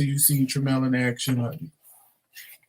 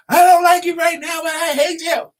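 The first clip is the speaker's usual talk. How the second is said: very high, louder hammed-up drama; movie-scene line; 12 semitones higher, 4 dB louder than usual. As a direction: overact the twist. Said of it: "Oh, this a movie scene".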